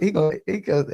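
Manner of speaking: ordinary delivery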